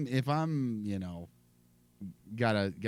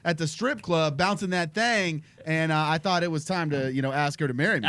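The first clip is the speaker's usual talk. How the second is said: mock dad voice; 6 semitones higher, 5 dB louder than usual. Plays the dad telling kid.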